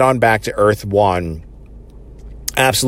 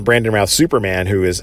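ordinary talk, nothing marked

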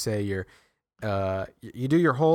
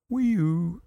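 short high siren call; a quick mouthed police siren; 8 semitones higher than usual. Pretend. Siren once more.

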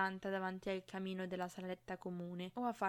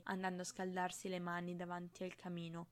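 ordinary delivery

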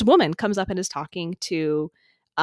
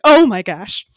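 excited gasp; 11 dB louder, slower than usual. Retold or imitated. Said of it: "And I was like".

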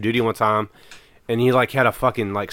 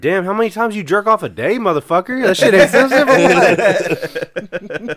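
mock disbelief; 8 semitones higher, 4 dB louder, slower than usual. Mocking his buddy in a quote.

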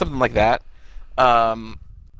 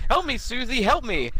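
high-pitched imitation of pleading; put-on, raised-pitch begging; mock plea; 9 semitones higher than usual. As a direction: call for help like an anime character. Imitates character calling for help.

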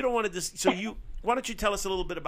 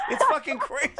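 laughing; 12 semitones higher, 3 dB louder than usual. Real, not acted. Laughing at crude joke.

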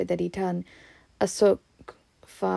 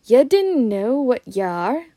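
loud, slow, higher-pitched disbelief; firm; 4 semitones higher, 8 dB louder than usual. A narrator's reading. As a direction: press the question firmly and slowly. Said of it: "he said firmly".

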